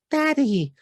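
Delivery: put-on very high little baby saying Daddy. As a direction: squeak it out like a tiny child. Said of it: "he looks at Robin and goes".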